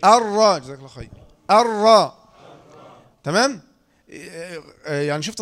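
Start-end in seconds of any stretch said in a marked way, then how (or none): 0.0-0.6 loud "الراء" with a brief, contained roll; controlled raa. Shown as a demonstration. Teacher drilling the student, loud controlled ر in "الراء". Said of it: "حركة أو حركتان. شوف". 1.5-2.3 loud "الراء" with a brief, contained roll; controlled raa. Shown as a demonstration. Teacher drilling the student, loud controlled ر in "الراء". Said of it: "حركة أو حركتان. شوف".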